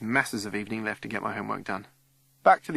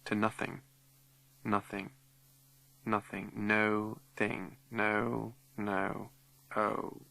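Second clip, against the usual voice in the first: much softer, slow fade to nothing; fading away; 7 dB quieter, slower than usual. Acted. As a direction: let the voice die like an echo. Echo fading to silence.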